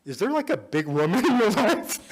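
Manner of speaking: high, loud, laughing through the words; a question that dissolves into laughter. Real, not acted. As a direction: ask while cracking up. Laughs while asking.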